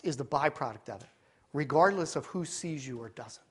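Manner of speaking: low, soft, solemn appeal; hushed preaching. Real, not acted. Preacher to congregation on true discipleship, hushed and earnest. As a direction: drop low and quiet, let it sink in.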